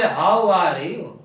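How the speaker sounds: slow, drawn-out low accent on "How are you?"; accent demo, third variant. Shown as a demonstration. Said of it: "और कोई बोले".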